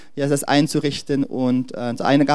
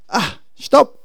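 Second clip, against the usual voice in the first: sudden, higher cry of halt; God calling stop; 6 semitones higher, 5 dB louder than usual. Acted. God halting people who get lost, abrupt call. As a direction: cut in with a raised-pitch stop. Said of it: "und dann sagt Gott".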